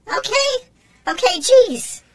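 very high squeaky little creature calling; shrill tiny tin-can critter; tiny robot voice. A narrator's reading. Tin can robot tugging Jesse's leg, high squeaky call.

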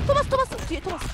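high urgent warning call; urgent callout. Real, not acted. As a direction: shout the warning to teammates. Warning teammates mid-fight. (다급한 외침)

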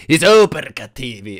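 a hint of a hammy super villain. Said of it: "faccio la voce del cattivo". (voce da cattivo accennata)

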